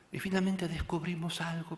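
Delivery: ordinary talk, nothing marked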